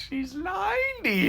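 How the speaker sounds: very high, drawn-out exclamation; incredulous mock shriek. Mover corrects his coworker on her age, high incredulous cry. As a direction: squeal it slowly in mock disbelief.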